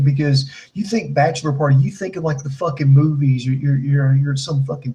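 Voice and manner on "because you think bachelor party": ordinary talk, nothing marked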